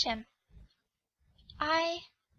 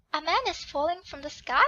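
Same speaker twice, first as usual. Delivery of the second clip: usual speaking voice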